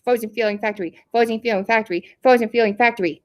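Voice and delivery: rapid tongue twister in a puppet voice; quick repeated patter; three times fast. Shown as a demonstration. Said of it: "try to say that three times fast".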